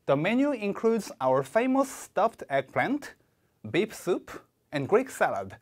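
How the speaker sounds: slow model reading, rise rise fall; "menu" up, "beef soup" up, "and Greek salad" down; read with intonation pattern. Shown as a demonstration. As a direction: slowly demonstrate the rise-rise-fall list tune. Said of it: "다음 문장 제가 읽어드릴게요".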